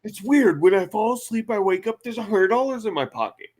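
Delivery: high, puzzled mock voice; lightly hammed confused friend; mock baffled John. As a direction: sound spooked by the money.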